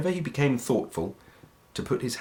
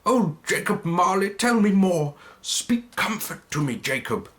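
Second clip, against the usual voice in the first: put-on higher, begging old man; imploring Scrooge; 9 semitones higher than usual. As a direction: plead with your old partner for comfort. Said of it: "he said imploringly".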